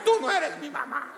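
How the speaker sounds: vocecita aguda de niño terco; niño caprichoso. Pretend. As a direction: reprocha como un niño. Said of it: "a los niños les gusta decir". (imitación aguda de niño)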